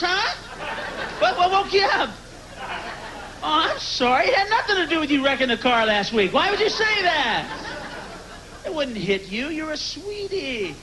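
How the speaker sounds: high sweet, mock-innocent husband voice; fake-innocent husband. Husband in the night feigning innocence to wife, sugary high mock-innocence. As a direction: play dumb and sugary with a sleepy wife. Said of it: "you can go".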